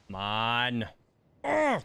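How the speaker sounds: ordinary talk, nothing marked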